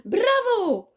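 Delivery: veel hoger en luid gejuicht; juichend. Acted. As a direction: juich het hoog en hard. (het biggetje juicht hoog en luid om het schot)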